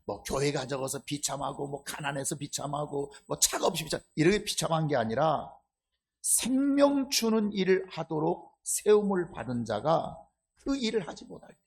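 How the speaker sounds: ordinary talk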